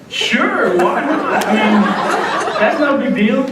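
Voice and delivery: giggling at his own gullibility; laughing through the words. Retold or imitated. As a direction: laugh while recalling how easily he agreed. Retelling his own naive thought.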